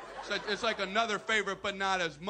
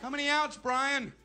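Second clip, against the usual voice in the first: raised, higher fielder's call; 7 semitones higher, 3 dB louder than usual. Acted. A fielder shouts over to Brian, calling out. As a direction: call across the ball field.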